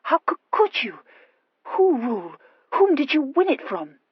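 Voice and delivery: high, halting, stammered astonishment; dumbfounded. Acted. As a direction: stumble over the question in bewilderment. Horatia questions Rule.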